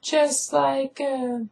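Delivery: very high falsetto held on one note; hammed-up refusal in a squeaky girl voice; mock lovelorn girl. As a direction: parody a stubborn, melodramatic lover. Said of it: "she's like".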